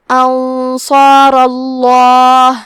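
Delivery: انصار اللہ chanted slowly with a thick, heavy ل in اللہ; heavy lam. Shown as a demonstration. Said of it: "حرف اللام کو موٹی آواز میں پڑھنا ہے". (انصار اللہ کا لام موٹی آواز میں، ٹھہر کر)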